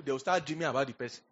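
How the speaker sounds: ordinary delivery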